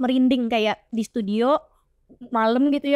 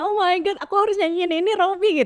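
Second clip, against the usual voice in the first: high-pitched, overwhelmed reenactment; flustered exclamation; 9 semitones higher than usual. Retold or imitated. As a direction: recount it as a high, startled plea. Recalls her startled reaction to Robi.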